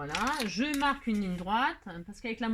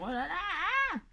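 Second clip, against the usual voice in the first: mock dismay; 8 semitones higher, much slower than usual. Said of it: "J'en connais une qui va dire".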